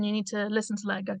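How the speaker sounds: ordinary talk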